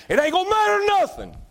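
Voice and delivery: sudden high squealing cry; high preaching cry. Acted. Preacher crying out to the congregation that titles mean nothing, high cry. Preacher hammering point on hell.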